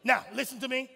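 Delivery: ordinary talk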